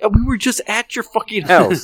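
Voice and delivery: high exasperated comeback; incredulous retort. Retold or imitated. Telling the drunk friend he just left home, high exasperated. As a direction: point out the obvious, incredulous. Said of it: "I was like".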